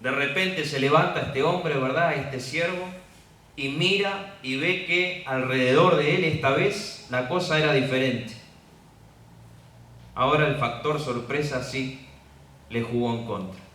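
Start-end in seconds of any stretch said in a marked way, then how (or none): none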